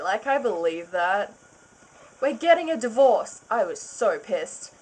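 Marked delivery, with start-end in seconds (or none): 2.2-3.4 angry declaration. Acted. Announces divorce in anger.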